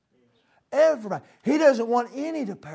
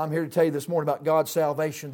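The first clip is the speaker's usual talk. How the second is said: normal talking voice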